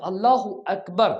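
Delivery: اللہ اکبر said slowly in Arabic, each word clear; slow, careful Arabic اللہ اکبر with a doubled l in اللہ; اللہ اکبر spoken deliberately in proper Arabic. Shown as a demonstration. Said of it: "یہ دو لفظ ہیں".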